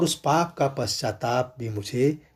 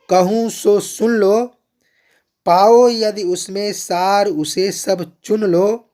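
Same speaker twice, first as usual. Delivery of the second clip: loud, higher declaimed verse, halting them; poem recitation; 5 semitones higher, 5 dB louder, slower than usual. Acted. Kaikeyi stopping the assembly to speak, loud declamation. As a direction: command the crowd to stop and listen.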